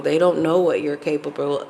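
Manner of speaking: usual speaking voice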